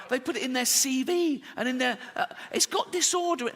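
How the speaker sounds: normal talking voice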